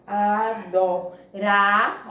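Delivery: "adorar" slowly split a-do-RA, RA loudest; stress on strong syllable. Shown as a demonstration. Teacher points out the strong syllable, slow syllable-by-syllable stress. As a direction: sound out "adorar" in syllables, punching the final RA. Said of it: "Sílaba forte, a penúltima".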